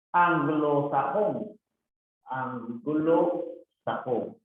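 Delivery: slow, syllable by syllable; sounded-out compound. Shown as a demonstration. Teacher showing a compound word, slowly sounded out. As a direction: stretch and separate the parts of the word.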